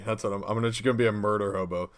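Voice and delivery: usual speaking voice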